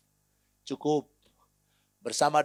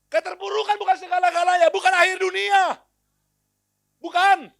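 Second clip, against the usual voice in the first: loud, much higher rallying cry; preacher shouting the line with the congregation; 7 dB louder than usual. Real, not acted. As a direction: shout it to lift the crowd.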